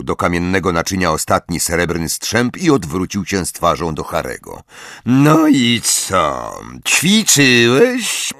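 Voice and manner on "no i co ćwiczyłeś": slow, drawn-out higher-pitched questioning; stern teacher's slow drawl; sneering interrogation. Acted. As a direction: stretch each word of the demand. Snape questions his pupil slowly.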